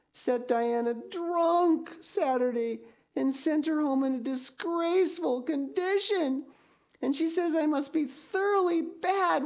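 high, tearful, mounting despair; wailing. Acted. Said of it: "she wailed".

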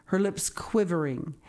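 ordinary delivery

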